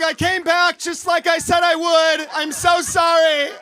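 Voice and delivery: loud, high mock scream; screaming apology. Returning dog walker yelling to the house's camera, mock screaming. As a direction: shout it toward the house, drawing out the sorry. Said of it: "I just started screaming at the house".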